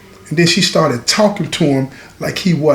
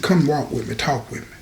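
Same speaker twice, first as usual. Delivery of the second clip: low, hushed aside to a friend; quiet coaxing; 5 semitones lower, 9 dB quieter than usual. Retold or imitated. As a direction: say it low and soft, trailing quieter. Quietly draws crying friend aside.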